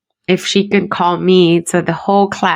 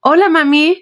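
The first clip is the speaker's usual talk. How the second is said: put-on higher, bright child's greeting on the phone; little boy voice; 9 semitones higher than usual. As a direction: imitate your four-year-old son saying hi. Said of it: "y le hace".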